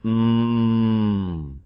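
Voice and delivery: a single steady hummed "Hmm" held long; one voiced m sound, hummed steady; hummed m demo. Shown as a demonstration. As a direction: hold one closed-lip "Hmm" so the throat buzzes. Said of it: "Ikuti saya, bunyikan".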